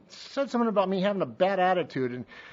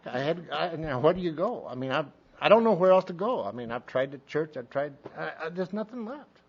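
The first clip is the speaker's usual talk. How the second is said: ordinary talk, nothing marked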